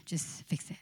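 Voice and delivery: low whispered urging; preacher whispers the inner pressure's temptation; tempting whisper. Acted. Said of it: "Don't trust God anymore".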